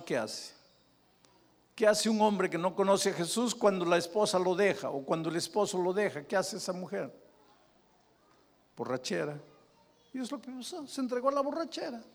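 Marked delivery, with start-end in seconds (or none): 1.7-12.0 rising preaching. Acted. Preacher at full force.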